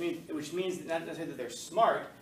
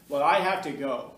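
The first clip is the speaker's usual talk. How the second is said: louder imitated drawl; student insisting in a John Wayne voice; 9 dB louder than usual. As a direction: put on a louder cowboy drawl. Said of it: "he talked like John Wayne".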